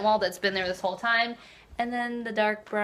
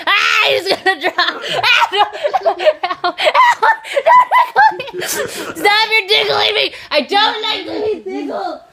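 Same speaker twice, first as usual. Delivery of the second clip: squealing, laughing, much higher and louder; being tickled; 13 semitones higher, 11 dB louder, slower than usual. Real, not acted. You're tickling me.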